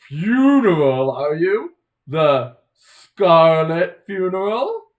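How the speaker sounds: FUNERAL stretched out slow and loud; drawn-out emphasis. Acted. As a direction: draw out "Scarlet funeral" into a loud, rising question. Acted character asks about the funeral.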